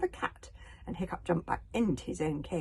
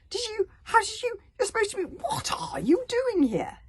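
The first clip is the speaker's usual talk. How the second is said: high, stammering disbelief, slowing at the end; flabbergasted; 7 dB louder than usual. Acted. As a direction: sputter in total shock. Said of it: "His eye popped".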